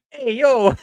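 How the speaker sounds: a quick, hammed-up Italian "Hey, yo", high and loud; mock Italian accent. Shown as a demonstration. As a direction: toss off a cartoon Italian greeting. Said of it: "I can't do an Italian accent".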